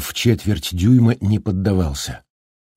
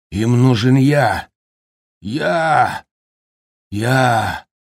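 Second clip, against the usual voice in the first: higher-pitched raving, slow and possessive; raving curse; 4 semitones higher, slower than usual. A narrator's reading. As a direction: rant like a possessed man. Jack cursing them possessively.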